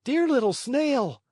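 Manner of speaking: calling up to a window in the rain; Pinocchio crying out. A narrator's reading. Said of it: "cried Pinocchio from the street".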